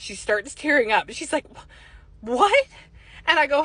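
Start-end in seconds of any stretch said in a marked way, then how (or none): 1.6-2.7 breath catches, then high "what"; stunned disbelief. Retold or imitated. Client hearing of her late mother, stunned. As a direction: imitate a stunned, faltering reaction. Woman stunned, tearing up at news.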